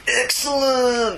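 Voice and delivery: high drawn-out surfer "Excellent"; Bill and Ted voice. Retold or imitated. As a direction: stretch it out like a stoner dude.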